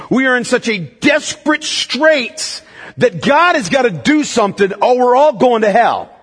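ordinary talk

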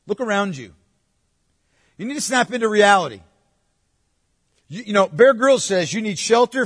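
worldly friend telling David to get practical; mock pushy advice. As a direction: push David like a know-it-all friend.

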